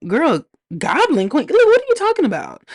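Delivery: incredulous, can't believe what she's hearing; baffled disbelief. Retold or imitated. Reviewer replaying her confusion at the name, high and baffled. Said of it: "'cause I was like".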